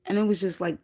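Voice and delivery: normal talking voice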